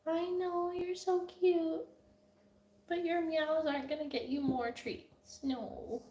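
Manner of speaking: high, soft coo to the cats. Real, not acted. Owner softly cooing to her cats begging for treats. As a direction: gently tease the begging cats.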